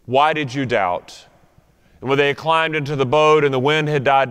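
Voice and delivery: solemn Bible reading; scripture reading. Acted. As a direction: read it like the lesson at the lectern. Reads scripture to congregation.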